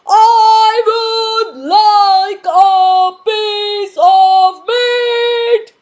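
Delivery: very loud, high, slow sung request; booming giant voice. Acted. Mister Noisy booming his order at the butcher. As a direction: boom it out, dragging every word. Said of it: "you know how he would ask".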